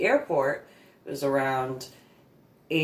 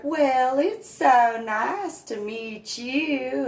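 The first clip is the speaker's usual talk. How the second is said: slow, high, syrupy mock Southern drawl; slow fake Southern accent, pitched high; 10 semitones higher, slower than usual. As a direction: imitate her fake Southern charm. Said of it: "that fake Southern accent that she did".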